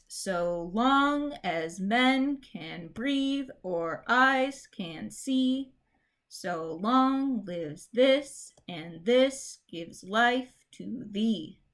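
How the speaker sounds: slow, sing-song with the beat on every second syllable; iambic reading. Shown as a demonstration. Teacher testing iambic meter on the couplet, slow and sing-song. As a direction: lean on each da-DUM. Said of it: "let's try this".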